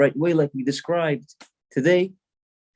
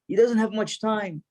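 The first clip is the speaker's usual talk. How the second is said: high-pitched, urgent plea at moderate volume; retold yell, pitched up; mock yell; 6 semitones higher than usual. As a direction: cry it out like a desperate father, without full volume. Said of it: "he yells out".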